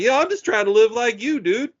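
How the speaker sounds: high-pitched, playful retold reply; retold banter back. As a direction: toss it back in a high mock voice. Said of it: "I'm just kinda mumbling shit back at him".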